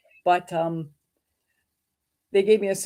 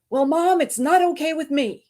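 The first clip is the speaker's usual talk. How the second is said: higher and louder daughter voice; daughter's protest; 8 semitones higher, 5 dB louder than usual. Retold or imitated. Daughter tells her mom it's not okay, higher and louder protest. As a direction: voice the daughter pushing back, pitched up. Daughter objects to mother's acceptance.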